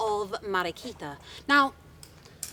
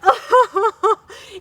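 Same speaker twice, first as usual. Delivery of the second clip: loud high fake sob; hammed-up wailing sob; 11 semitones higher, 12 dB louder than usual. Upset wife sobbing at her husband, loud high mock sob. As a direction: burst into mock sobs. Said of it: "she got even more upset".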